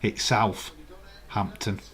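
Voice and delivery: slow South-hampton, the th and h both sounded; split into two words. Shown as a demonstration. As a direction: pull Southampton apart into South and hampton, slowly. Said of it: "but to our William".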